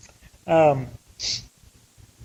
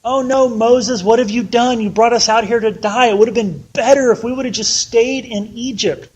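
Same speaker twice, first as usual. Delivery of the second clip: whiny complaining; 4 semitones higher, 4 dB louder than usual. Retold or imitated. Israelites whining at Moses in panic. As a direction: whimper it like frightened grumblers. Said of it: "They start complaining".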